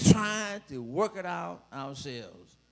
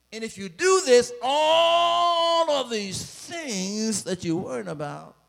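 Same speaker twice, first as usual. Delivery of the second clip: louder, higher, slow and ringing; full-force preaching; 6 semitones higher, 6 dB louder, slower than usual. Acted. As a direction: proclaim the verse to the whole church.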